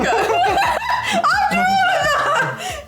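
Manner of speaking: giggling while talking; laughing with a full mouth; laughing through words. Real, not acted.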